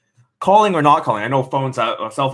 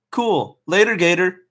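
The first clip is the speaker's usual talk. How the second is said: breezy friend; 8 semitones higher than usual. Acted.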